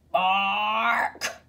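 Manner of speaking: long, rough drawn-out bark. A narrator's reading. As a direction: draw the word out like a dog's bark. Narrator imitates watchdog barking alarm.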